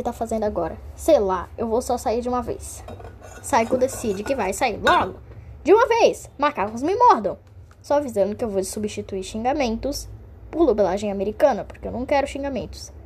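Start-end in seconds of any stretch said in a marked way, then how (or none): 5.6-7.4 high, loud burst for a fanfic hero; excited character outburst. Acted. Saiko bursting out as he decides to leave, high and loud. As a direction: fling it out like a cartoon catchphrase.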